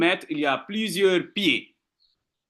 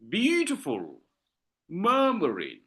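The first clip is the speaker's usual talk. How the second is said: "beautiful" and "murmuring" softer, first syllables held; dactylic stress; 5 dB quieter than usual. Shown as a demonstration. Teacher modelling dactyls for class, "beautiful" and "murmuring" stressed at the front. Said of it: "pronunciation is very important".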